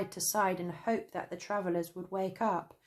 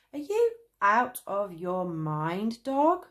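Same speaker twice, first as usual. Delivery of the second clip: snapping; 2 semitones higher, 6 dB louder, slower than usual. A narrator's reading. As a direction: bite back at the dog. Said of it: "snapped Traveller Cane".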